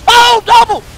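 triumphant shouts. Real, not acted. Gamer celebrating a double kill, shouting high and loud.

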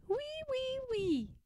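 very high, soft piglet wee; giggling piglet squeal. Acted. Alexander giggling at his mother, very high squeal. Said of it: "giggled Alexander".

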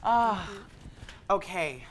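ordinary talk, nothing marked

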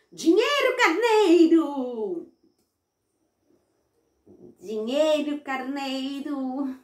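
high drawn-out chant; boy showing mom the magic words, high and drawn out. Acted.

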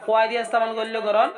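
ordinary talk, nothing marked

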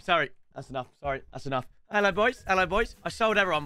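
ordinary delivery

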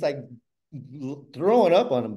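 ordinary delivery

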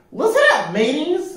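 very high parrot voice; announcing parrot. A narrator's reading. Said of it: "announced the parrot".

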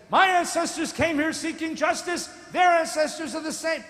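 loud, rising protest of an activist; a loud, indignant Romanian-free activist cry. Retold or imitated. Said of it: "who says".

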